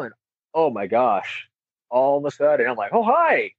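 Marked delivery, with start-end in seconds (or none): 2.9-3.5 high-pitched startled little hello; jolted out of a trance, pitch jumping up; startled greeting. Acted. Runner in a trance suddenly greeted by officer, startled.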